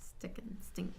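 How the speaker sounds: soft unvoiced whisper; a quiet whispered mull-over. Real, not acted. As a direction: whisper the two words to yourself. Muttering the two options.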